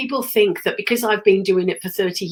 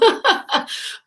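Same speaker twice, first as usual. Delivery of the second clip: breathy laugh; 13 semitones higher than usual. Real, not acted. Laughs at own illness.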